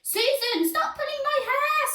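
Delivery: put-on very high girlish squeal. A narrator's reading. Vain pupil complains to classmate, squealing. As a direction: squeal it in protest. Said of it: "squealed Vain Violet".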